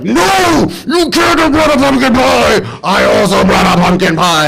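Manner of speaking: very high, loud, jealous shriek; shrill furious shout. Retold or imitated. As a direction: scream it like a jealous rival. Heather stomps over, pie rivalry.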